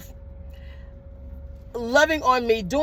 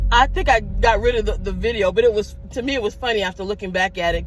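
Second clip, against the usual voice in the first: ordinary talk, nothing marked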